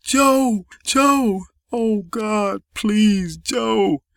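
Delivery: high anguished cries; screaming. A narrator's reading. Deborah finding Joe dead in the street, anguished screaming. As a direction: cry out high, pleading in horror.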